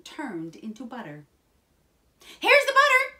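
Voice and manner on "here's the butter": very high, loud cry; excited shout. A narrator's reading. Jack announces the butter to his mother, excited shout. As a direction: yell it in triumph, pitched way up. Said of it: "shouted Jack".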